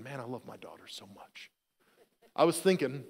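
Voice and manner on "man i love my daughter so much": soft, low musing; mock-tender inner voice, much softer; tender thought. Dad's imagined thought about his daughter, soft mock-tender musing. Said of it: "I wasn't thinking".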